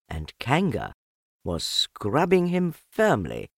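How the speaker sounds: usual speaking voice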